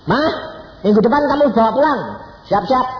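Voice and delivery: loud, high announcement; acted urgent loud notice; calling to mama. Calls out news to mama.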